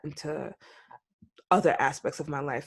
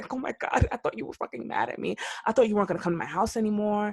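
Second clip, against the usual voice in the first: high, fast rush of relief; relieved surprise; 5 semitones higher, faster than usual. Retold or imitated. Telling a friend how relieved she was, high and fast. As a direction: gush out your relief, higher and quicker. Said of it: "And I was like".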